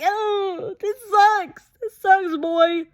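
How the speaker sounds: high, whiny fake sob; hammed-up wail over a sad scene; a playful hammed-up sob of heartbreak; mock crying. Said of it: "So I'm like".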